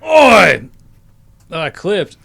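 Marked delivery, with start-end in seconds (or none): 0.0-0.7 loud, high biker's bellow; biker shout. Acted. Biker opening a scripted bar scene, hammed-up shout. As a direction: yell it out as a rowdy biker. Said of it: "or gruff voices".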